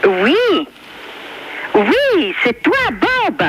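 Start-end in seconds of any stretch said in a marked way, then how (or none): none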